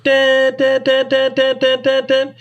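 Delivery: high clipped duck call imitation; sharp staccato call notes up high; imitated hail call notes. Shown as a demonstration. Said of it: "It's gonna be like".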